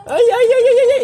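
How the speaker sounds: quick string of high, loud yelps; real alarmed exclamation. Real, not acted. Adult teasing an angry little child, high excited exclamation.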